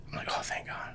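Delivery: a secret sigh of relief; relieved whisper. Retold or imitated. To himself as his drawer gets dumped, a relieved whisper. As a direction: breathe it out in a whispered thank God.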